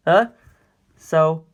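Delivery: plain reading voice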